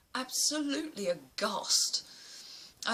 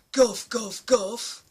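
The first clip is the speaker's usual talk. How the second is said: mocking taunt; 9 dB louder, much slower than usual. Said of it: "one of them goes".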